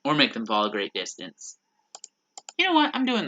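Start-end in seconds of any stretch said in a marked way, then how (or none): 2.6-3.3 squeaky. Real, not acted. Sudden squeak mid-play.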